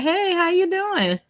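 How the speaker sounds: high, drawn-out friendly hello; hallway greeting. Retold or imitated. Woman greeting coworker in the hallway, high sing-song hello. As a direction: stretch the hey way up high.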